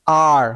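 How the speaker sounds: আর with the mouth wide open. Shown as a demonstration. Teacher answering what A and R make, wide-open আর. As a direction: open right up on আর. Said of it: "হা করতে হবে".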